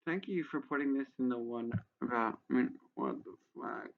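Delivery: usual speaking voice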